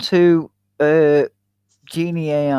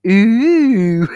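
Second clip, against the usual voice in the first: impressed ooh; 7 semitones higher, 4 dB louder than usual. Retold or imitated. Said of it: "And he just went".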